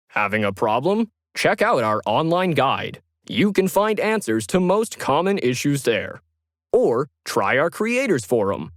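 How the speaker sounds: company ad loop while Lauren waits; hold-line ad read. Acted. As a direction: sell it like a cheerful hold message. Recorded hold ads.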